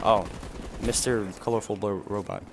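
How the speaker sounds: usual speaking voice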